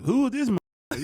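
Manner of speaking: imitating a suspicious celebrity comic; mock gruff comic. Comic Bernie questioning the newcomer, loud and indignant. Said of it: "asking us".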